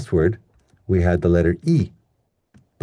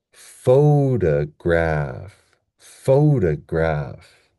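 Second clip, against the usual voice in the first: "photograph" very slow, long PHO, secondary stress on graph; stress on first syllable; much slower than usual. Shown as a demonstration. Teacher modelling stress levels, very drawn-out "photograph" led by PHO. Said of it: "we have the stress at the beginning".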